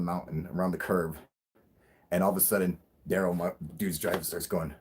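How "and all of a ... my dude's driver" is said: ordinary delivery, nothing marked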